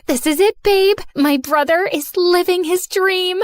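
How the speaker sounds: high, loud, thrilled squeal; excited squeal. A narrator's reading. Friend celebrates her brother's first gig, high excited squeal. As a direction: squeal with delight. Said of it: "Bex squealed".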